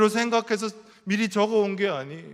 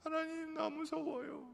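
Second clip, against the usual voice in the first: much higher and much softer, a fragile plea; hushed plea; 9 semitones higher, 13 dB quieter than usual. Retold or imitated. Pastors' wives quietly confessing fear to God, high and hushed. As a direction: breathe the confession to God, small and scared. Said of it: "부르면서".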